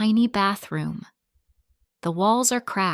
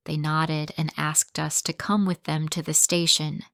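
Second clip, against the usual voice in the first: usual speaking voice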